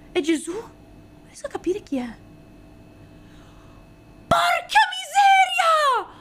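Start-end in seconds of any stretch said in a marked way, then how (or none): 0.5-2.1 breathy puzzled whisper under the breath; voiceless whisper, puzzling over a card. Real, not acted. 4.3-6.1 slow, high, loud yell of disbelief; real thrilled shriek, high and stretched; excited shout. Real, not acted. Pulls a great card.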